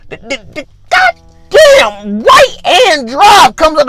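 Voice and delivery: high, drawn-out exclamation; stutter, then burst out high and slow; mock alarm. Worker hit by right-hand drive, exclaiming in mock alarm.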